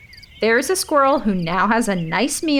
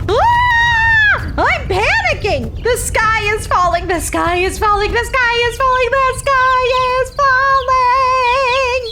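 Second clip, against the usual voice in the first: panicked shrieking; 14 semitones higher, 4 dB louder than usual. Acted. Mom hen running in circles, panicked high shouting. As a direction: shriek it like the world is ending, stretching the last line. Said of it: "Penny's mom began to run in circles".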